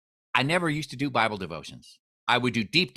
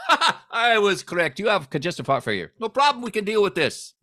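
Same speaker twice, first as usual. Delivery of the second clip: put-on cheerful doctor, higher pitched, Indian accent; upbeat Indian-accented doctor; 8 semitones higher, 4 dB louder than usual. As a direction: chuckle through the Indian accent. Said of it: "and he goes".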